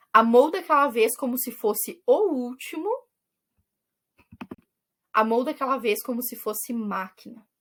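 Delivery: measured reading of lyric lines, pausing between them; reciting verses. Acted. Teacher reading song verses to students, in a measured recital. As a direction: read each verse like a poem to the class. Reads the song's verses aloud.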